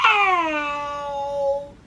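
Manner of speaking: sharp cry of pain. Real, not acted. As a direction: yelp as something hurts. Hurts himself during the take.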